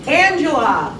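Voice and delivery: normal talking voice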